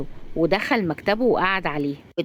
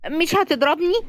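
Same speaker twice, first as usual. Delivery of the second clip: high, small-voiced question; high female-character plea; childlike pleading; 9 semitones higher than usual. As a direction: check timidly, pitched way up. Razan hiding, asks for reassurance.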